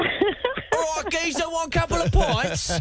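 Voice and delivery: high-pitched mock London pub-landlord accent; London bartender accent. Host imitating caller as London pub bartender, mock English accent. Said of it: "bartender behind the bar in a, in a London pub".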